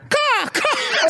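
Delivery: high squawking bird call; very high mock caws; cawing bird. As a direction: imitate a bird butting in. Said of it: "And then you hear".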